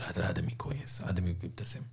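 voiceless aside, like an inner thought; hushed voiceless approval; whisper. Retold or imitated. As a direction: whisper it as a secret impression. Voicing the listener's private thought.

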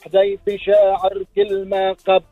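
zajal recitation. Acted. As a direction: declaim it forcefully. Rival poet's verse. (إلقاء زجل بقوة)

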